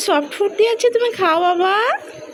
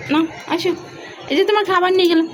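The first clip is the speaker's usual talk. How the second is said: ordinary talk, nothing marked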